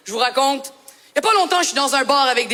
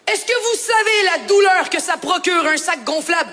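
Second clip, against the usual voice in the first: normal talking voice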